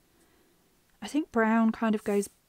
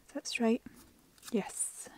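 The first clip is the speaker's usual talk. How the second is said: whispering; 6 dB quieter than usual. Real, not acted. Whispering while placing stickers.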